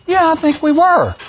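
high-pitched acted classmate reply; mock reunion chat. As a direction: play the recognising classmate, pitched well up. Acted reunion couple in retold commercial.

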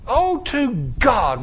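slow, yearning plea; earnest longing. Real, not acted. Preacher longing for a life of faith, slowly. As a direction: draw the wish out. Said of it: "sermon plea".

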